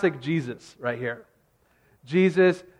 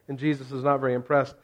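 ordinary talk, nothing marked

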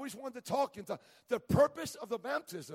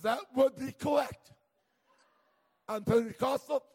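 bold loud declaration; 6 dB louder than usual. Retold or imitated. Confessing to the dentist he's Pentecostal, bold and loud. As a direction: loudly claim it with pride.

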